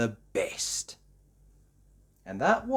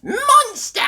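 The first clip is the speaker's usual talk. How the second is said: very high, full-voiced shout; loud child's call; 11 dB louder than usual. A narrator's reading. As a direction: yell it as loudly as a little one can. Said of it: "called George as loudly as he could".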